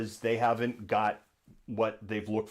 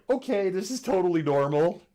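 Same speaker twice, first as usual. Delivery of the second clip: sarcastic; 9 semitones higher, 5 dB louder than usual. Retold or imitated.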